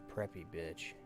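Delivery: ordinary delivery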